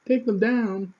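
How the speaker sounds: high, loud disbelief, almost a yelp; incredulous outburst. Real, not acted. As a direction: throw it back at her, stunned. Outraged reply to principal's demand.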